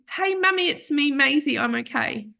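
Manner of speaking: high, sweet little puppy voice speaking to mummy; puppy's voice. Pretend. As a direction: voice the puppy's eyes talking, tender and high. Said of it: "her little eye said to me".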